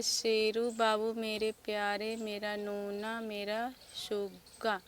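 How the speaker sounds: put-on slow, drawn-out, loud reading of a child's note; mother reads son's words on the grave marker, slowly; reading the flag aloud. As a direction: read each pet name out slowly, lovingly. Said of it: "दूसरी तरफ भी वही लिखा है".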